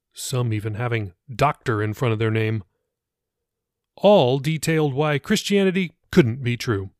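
usual speaking voice

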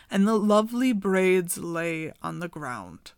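usual speaking voice

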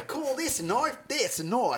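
high, sneering Australian take on the knife line; Australian knife line pitched high; Crocodile Dundee impression. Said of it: "Jesse's better".

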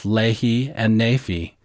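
"Lehi and Nephi" as LAY-hee and NAY-fee; "Lehi and Nephi" the Semitic way, LAY-hee, NAY-fee; Arabian pronunciation. Shown as a demonstration. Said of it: "we always use their pronunciation".

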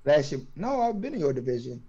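normal talking voice

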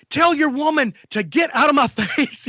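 put-on retelling an old man yelling at a drunk woman's boyfriend; going off on her. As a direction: tell the boyfriend off, loud, laughing through it. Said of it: "he's going off on this chick".

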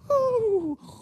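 high, falling mock death noise; hammed-up sound of the stricken man; imitated dying noise. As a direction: act out his last gasp. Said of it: "he went like".

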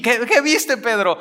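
high, excited "what did you see"; a squeaky, impatient outburst; eager demand. Pretend. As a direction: pester him to tell. Listener begs Peter to tell.

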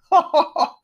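big high aristocratic guffaw; hearty laugh. Acted. Lord laughing heartily at Jack's remark. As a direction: laugh out loud at the boy. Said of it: "laughed heartily".

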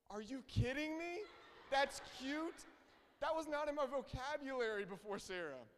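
high, loud incredulous protest; mock disbelief. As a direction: play up being appalled at your own words. Mock disbelief at his own words.

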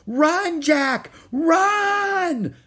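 high, drawn-out scream of alarm; crying out. A narrator's reading. Annie to Jack as pirates charge, desperate shout. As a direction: yell it desperately, stretching the last word. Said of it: "cried Annie".

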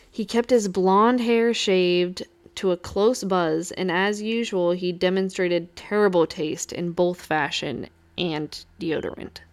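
usual speaking voice

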